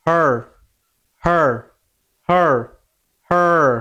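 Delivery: হার with the final R held on; don't release the R in হার. Shown as a demonstration. Teacher showing it sounds like the previous word, held R in হার. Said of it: "ধরে রাখতে হবে একটু".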